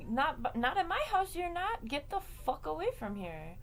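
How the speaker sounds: high, loud indignant telling-off; acted-out angry outburst; angry scolding. Ordering kid away, retold.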